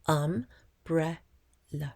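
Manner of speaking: "um, bre, la" syllable by syllable; split into syllables. Shown as a demonstration. Child segments umbrella into syllables, split evenly with pauses. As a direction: clear pauses between "um", "bre" and "la". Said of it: "segment the word into syllables".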